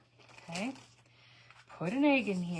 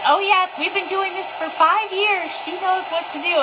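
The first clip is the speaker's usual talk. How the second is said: high, bright mock-proud mother; proud mom; 11 semitones higher, 8 dB louder than usual. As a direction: imitate the mom boasting about her daughter. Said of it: "her mom goes".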